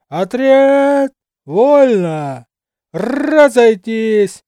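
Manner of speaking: loud parade-ground shout; booming command. A narrator's reading. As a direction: call the orders out, resonant. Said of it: "зычной команды".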